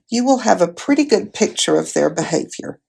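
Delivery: ordinary delivery, nothing marked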